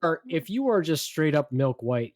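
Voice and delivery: ordinary delivery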